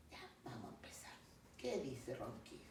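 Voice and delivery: ordinary delivery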